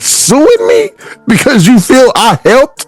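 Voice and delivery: a label head scolding his own artist; label boss. Acted. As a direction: play the record label exec, outraged. Role-plays label boss confronting artist.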